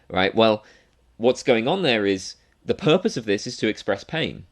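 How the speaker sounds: ordinary delivery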